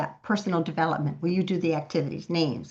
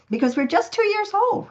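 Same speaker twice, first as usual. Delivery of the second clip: "two" leaping much higher; thrilled it's so new; excited; 3 dB louder than usual. Real, not acted.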